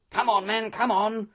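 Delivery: high, urgent rallying call; mild shout. A narrator's reading. General urging his men to board, mild shout. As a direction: hurry the troops along. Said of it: "the general shouted".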